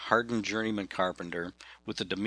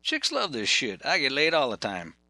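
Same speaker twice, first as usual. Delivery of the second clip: put-on higher, louder bragging Southern kid; cocky young guy; 6 semitones higher, 3 dB louder than usual. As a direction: swagger like a young ex-con. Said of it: "He said".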